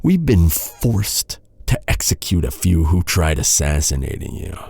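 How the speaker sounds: usual speaking voice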